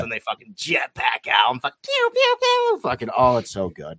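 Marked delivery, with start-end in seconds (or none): 1.8-2.8 high imitated blaster shots; blaster pew sounds. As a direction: fire off high pews.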